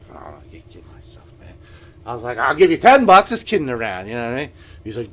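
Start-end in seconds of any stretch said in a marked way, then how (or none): 0.1-1.5 whispered doubt, much softer. Retold or imitated. Doubting himself over the guitar. 2.4-4.1 a loud, higher eager offer. Retold or imitated.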